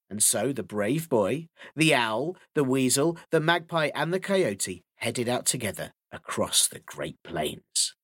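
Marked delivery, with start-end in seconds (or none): none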